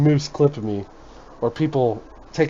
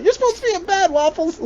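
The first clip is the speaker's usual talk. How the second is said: high-pitched squeal of a telling-off; mock scolding; 4 dB louder than usual. Retelling last night's bedtime order to Waffles, mock scolding. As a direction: scold in a much higher, louder voice. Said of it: "But it was a deeper voice".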